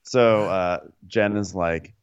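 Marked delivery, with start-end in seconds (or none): none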